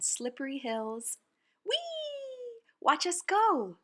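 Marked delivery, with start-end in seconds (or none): 1.7-2.6 high, delighted squeal; gleeful whee. Acted. Kids sliding down a snowy hill, squealing. 2.8-3.8 high, loud and thrilled; excited shout. Acted. Children shouting to onlookers while sledding, excited.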